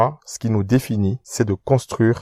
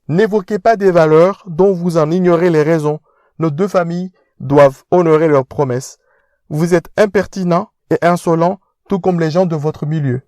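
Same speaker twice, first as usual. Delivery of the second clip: higher, louder haughty upper-class voice; pompous aristocrat lecturing; posh fiancé scolding; 7 semitones higher, 3 dB louder than usual. Acted. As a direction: talk down to him.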